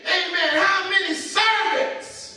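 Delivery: rising anguished preacher's voice; prodigal son's cry. Acted. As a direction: cry out how hungry he is. Son quoting his realisation.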